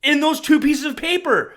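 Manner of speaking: exasperated emphatic answer; emphatic outburst. Real, not acted. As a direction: hammer home that's it. Emphatic answer after mocking.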